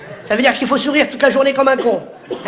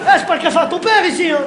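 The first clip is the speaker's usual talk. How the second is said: mock angry yelling; 7 semitones higher than usual. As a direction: rage at the customers in the café. Waiter yelling at customers.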